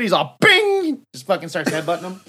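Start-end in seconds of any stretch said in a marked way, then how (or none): 0.4-1.0 a sudden high cartoon "bing"; ping it out like a bell; high "bing" noise. Headbutt noise.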